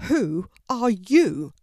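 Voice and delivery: loud, slow, commanding question; stern father demanding an answer; authoritative voice. A narrator's reading. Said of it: "in a deep, authoritative voice".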